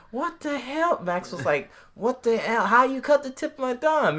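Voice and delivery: very high-pitched retold outburst; mock incredulous. Retelling Max reacting to the cut thumb, mock incredulous. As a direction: squeal it in hammed-up disbelief.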